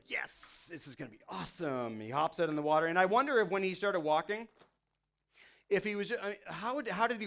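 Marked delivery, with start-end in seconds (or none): 0.1-2.0 whispered thrill. Retold or imitated. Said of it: "And Peter's like".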